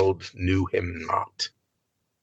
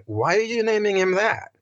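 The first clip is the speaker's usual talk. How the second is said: puzzled question; 10 semitones higher than usual. Retold or imitated.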